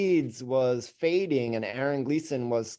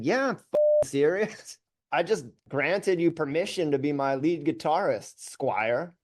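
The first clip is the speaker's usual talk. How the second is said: high, cocky mock-grand reply; arrogant retort; 4 semitones higher than usual. Young singer sneering back at his guitarist, high and cocky. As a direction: play the arrogant young star. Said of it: "And I said".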